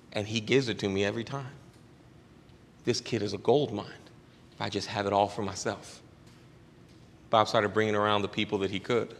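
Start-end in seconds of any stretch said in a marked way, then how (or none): none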